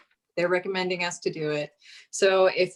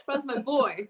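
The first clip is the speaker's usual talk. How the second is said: laughing; 6 semitones higher, 3 dB quieter, slower than usual. Real, not acted. Proudly defending Rob, laughing.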